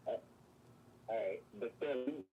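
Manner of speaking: muffled remote voice. Real, not acted. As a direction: voice muffled by headphones. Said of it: "You sound muffled".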